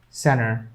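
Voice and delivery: "center" as "cenner", no T; American silent T. Shown as a demonstration. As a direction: say "center" with the T left out. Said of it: "they keep that T silent".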